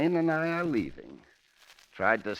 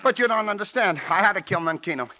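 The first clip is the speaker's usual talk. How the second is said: desperate pleading; 7 semitones higher, 5 dB louder, faster than usual. Acted. Rocky pleads, caught.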